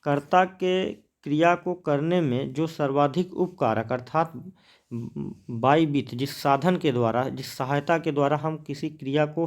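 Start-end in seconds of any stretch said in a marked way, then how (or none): none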